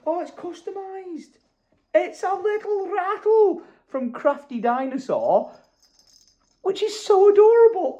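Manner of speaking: touched gasp. Real, not acted. Gasps over customised rattle.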